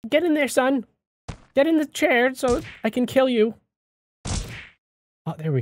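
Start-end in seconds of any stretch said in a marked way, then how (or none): 0.1-3.5 lightly voiced dad, higher; mock dad voice. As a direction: coax him like a dad to his son. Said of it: "I feel like his dad".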